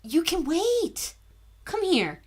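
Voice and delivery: high sing-song dog voice; pet talk to the dog. Real, not acted.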